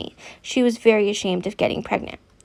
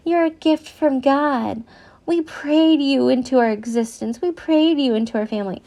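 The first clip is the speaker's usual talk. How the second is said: mocking adoptive parents; 4 semitones higher than usual. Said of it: "I hate those".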